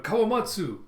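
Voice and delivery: high, loud imitated shout of a name; calling out. Kinemon calling to his comrade in battle, imitated shout. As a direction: call out urgently to a comrade.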